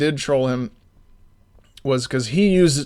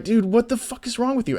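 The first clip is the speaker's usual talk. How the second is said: higher, fed-up disbelief; exasperated mock outburst; 9 semitones higher than usual. To the coworker who brought a fork, exasperated and higher. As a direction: throw your hands up at them, pitched up.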